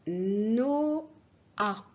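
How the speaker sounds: "no-a" drawn out as two separate vowels, loud; split syllables. Shown as a demonstration. Teacher sounding out a syllable for pupils, "no-a" split in two. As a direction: sound out "no-a" with a long "no", then "a". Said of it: "on ne dit pas".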